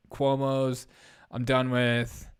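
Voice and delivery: usual speaking voice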